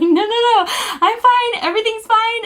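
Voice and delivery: very high, loud squealed reassurance; imitating a tearful-sounding, giddy insistence; hysterical, almost crying. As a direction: squeal it as if helpless with laughter. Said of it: "crying because I was laughing so hard".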